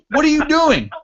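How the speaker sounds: high-pitched disbelief, acting out a buddy; imitated incredulous holler. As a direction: holler it high, as if through a toilet door. Buddy calls in, laughing.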